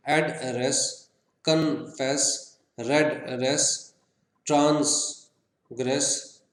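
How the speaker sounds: एड्रेस, कन्फेस, रेड रेस, ट्रांसग्रेस slowly, each in two separate beats; two-syllable split. Shown as a demonstration. Teacher listing two-syllable words, splitting एड्रेस, कन्फेस, रेड रेस, ट्रांसग्रेस. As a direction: pause midway in each: एड-रेस, कन-फेस, रेड-रेस, ट्रांस-ग्रेस. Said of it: "टू syllables कौन से".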